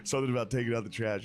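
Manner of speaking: ordinary talk, nothing marked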